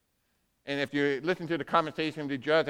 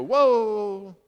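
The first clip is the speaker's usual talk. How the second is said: louder, higher startled whoa; a commander caught off guard; surprised whoa; 4 semitones higher, 5 dB louder than usual. Retold or imitated. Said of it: "the commander go".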